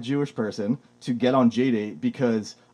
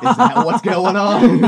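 laughing, playing dumb; laughing, mock innocent question; laughing sarcasm; 8 semitones higher, 6 dB louder, slower than usual. Mock reply to coach.

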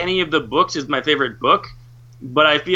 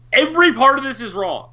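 retold enraged; 7 semitones higher than usual. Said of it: "I was legitimately enraged".

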